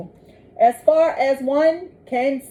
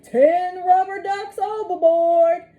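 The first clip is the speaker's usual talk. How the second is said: higher, slower echoed call; 7 semitones higher than usual. Acted. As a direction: echo the shout back slowly. Echo of captain's shout.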